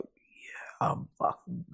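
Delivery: whispered, breathless disbelief; excited whisper. Retold or imitated. Surfer quietly celebrating beating another rider, whispered. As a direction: hush it like a secret win. Retold private thrill.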